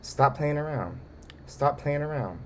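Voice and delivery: unbothered. Retold or imitated. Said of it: "acting like she don't even care".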